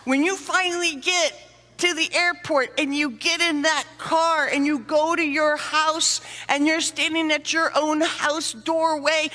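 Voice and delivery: ordinary talk, nothing marked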